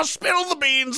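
shouted mock demand. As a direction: bark it like an interrogator. Mock interrogation of politicians.

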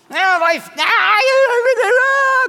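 high, loud hammed-up wail of despair; mock wailing. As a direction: ham up a melodramatic meltdown. Mock crying over bad day.

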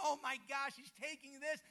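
loud, high gasp of wonder; mock astonishment; amazed disciples. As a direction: disciples amazed at the multiplying bread. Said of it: "The disciples are like".

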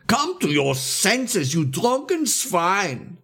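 slow, high, scolding cry; bellowing. A narrator's reading. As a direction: bellow it out word by word. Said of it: "suddenly bellowed with an extraordinary force".

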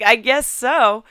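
loud, slow, sarcastic; snippy retort. Retold or imitated. Mom snaps back at daughter, loud and slow. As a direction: say it flat out, slow and pointed.

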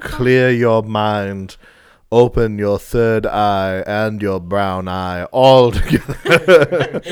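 slow, hammed-up guided meditation; mock meditation voice. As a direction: drag it out like a calm guru.

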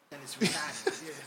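slow Boston "retahded", R dropped, laughing; Boston accent. Shown as a demonstration. Co-host showing how Boston says it, Boston accent. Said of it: "unless you're from Boston".

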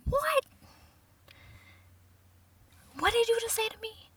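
high, soft mock shock; stunned disbelief. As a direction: squeak it out quietly, as if affronted. Acted shock at being called pregnant.

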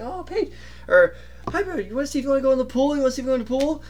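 put-on high, fast baby-talk to a puppy; high coaxing puppy voice; talking to the dog. Sister baby-talks to the dog.

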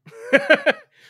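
very high, loud cackle; sudden shrieking giggle; real burst of laughter. Real, not acted. Host cracking up at rude example, real cackle.

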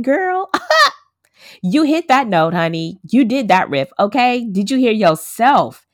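high, loud retold cheer with a laugh; excited self-hype. Speaker retelling her praise of her own singing, excited and loud. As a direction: hype yourself up, loud and lifted, like a proud girlfriend. Hyping herself up after recorded singing.

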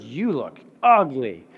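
slow, taunting schoolyard voice; mean kid. Retold or imitated. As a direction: sneer it like a rude kindergartner. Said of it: "some kid looks at you and goes".

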